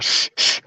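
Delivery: sharp, voiceless "shh, shh"; urgent shushing. Acted. Guys silencing the noisy group, urgent shushing. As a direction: hush the group with two quick shushes.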